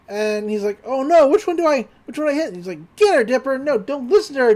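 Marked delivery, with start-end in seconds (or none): 0.8-2.5 higher, mock-frantic dithering; panicked confusion. Dipper torn between two Wendys. 3.0-4.5 higher, acted urging; urgent retelling. Two Wendys urge Dipper.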